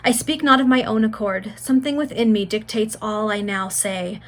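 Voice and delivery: ordinary delivery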